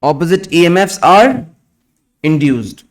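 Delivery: slow, word by word dictation; dictating. Acted. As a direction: dictate slowly for students to write. Dictation continues.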